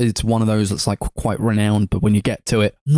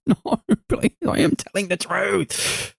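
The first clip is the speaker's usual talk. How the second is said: high, drawn-out mock plea; desperate pleading; 10 semitones higher, 4 dB quieter, slower than usual. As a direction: beg melodramatically to be believed. Acting out Nora begging belief.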